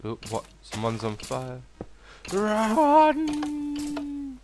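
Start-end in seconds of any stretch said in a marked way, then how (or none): 2.3-4.4 very high, loud alarmed shout. Real, not acted. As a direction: yell a warning to flee. Mob catches fire.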